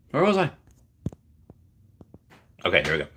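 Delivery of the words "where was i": Where was I? lifted much higher, sing-song on "was". Shown as a demonstration. Lost his place on stream, a high sing-song "was". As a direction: float "was" up high like a playful question.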